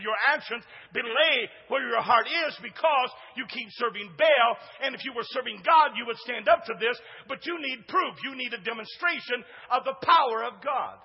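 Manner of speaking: rising preaching. Acted. Pastor urging the congregation about Elijah, rising preaching. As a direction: push the point about serving Baal.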